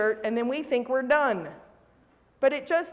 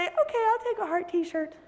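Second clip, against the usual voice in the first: hammed-up giving in; cartoon sulky surrender; high mock resignation; 8 semitones higher than usual.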